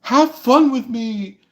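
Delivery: high, slow, sultry tease; flirty come-on voice. Retold or imitated. Tarot reader mimics a flirty stranger inviting fun. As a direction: lure everyone in with a breathy come-hither. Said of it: "going like".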